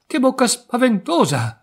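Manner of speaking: high-pitched storybook alarm; acted fright, pitched well up; alarmed girl. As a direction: exclaim it high, as a frightened child. Girl alarmed by grandmother's mouth.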